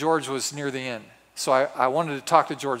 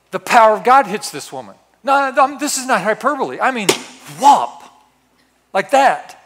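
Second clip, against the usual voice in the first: louder and higher, pressing hard; forceful emphasis; 5 semitones higher, 7 dB louder than usual. Acted. Preacher insisting this is no exaggeration, loud and high. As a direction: hammer it home with full force. Describing God's power hitting.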